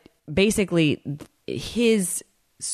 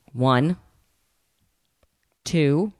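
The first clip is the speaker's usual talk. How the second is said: ONE, then a very long pause before TWO; imitating a painfully slow count, "One ... two". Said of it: "this is how long the pauses are".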